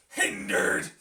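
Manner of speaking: "Hindered" spat out loud and short; a loud, quick jab on "Hindered"; jabby bite. Shown as a demonstration. Streamer testing the insult's bite, a hard jab. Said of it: "It's a little jabby".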